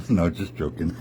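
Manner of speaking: plain reading voice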